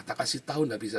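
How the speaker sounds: ordinary talk, nothing marked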